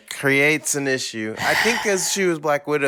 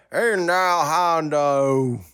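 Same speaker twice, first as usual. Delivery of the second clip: put-on drunk cowboy; 2 semitones higher, much slower than usual. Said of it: "That's like a kinda drunk cowboy".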